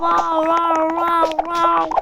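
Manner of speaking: echoing the game's loud cry; loud wow. Retold or imitated. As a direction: shout the wows back.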